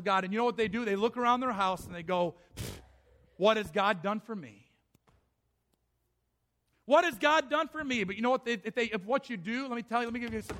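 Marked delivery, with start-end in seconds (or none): 2.6-2.8 scornful pfft. Acted. Scornful noise before complaint. 6.9-8.0 higher, louder bitter complaint; bitter outcry. Acted. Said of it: "repeated louder".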